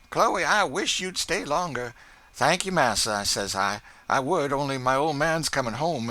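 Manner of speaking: high, chatty, pleased with herself; showing off her good name; Chloe's proud dialect voice. Acted. Chloe boasts to her mistress about Massa Jones, high proud chat. Chloe retells talk with employer.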